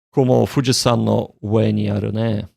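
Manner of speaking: usual speaking voice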